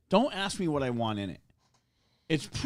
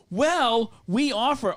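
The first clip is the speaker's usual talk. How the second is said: high, louder mimic of the clerk; mock worker voice; 10 semitones higher, 6 dB louder, much slower than usual. Retelling the sub shop, mimicking the clerk with a high, loud voice. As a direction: parrot the sandwich lady, snooty.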